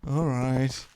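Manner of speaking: a light take on a fed-up ship computer; lightly voiced disgruntled computer; grumpy computer voice. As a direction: say it like a machine that's sick of you.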